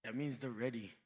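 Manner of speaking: mock-solemn wise man; grave elder. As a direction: pronounce it like an oracle. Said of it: "I was like".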